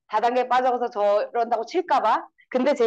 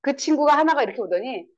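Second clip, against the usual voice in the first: normal talking voice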